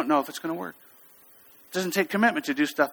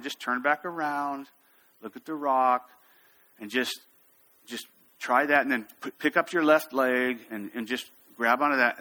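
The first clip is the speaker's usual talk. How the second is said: really calm; 2 semitones lower than usual. Retold or imitated. Instructor coaching a frightened climber, very calm. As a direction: talk them through each step, unhurried and low-key. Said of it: "I'm staying really calm".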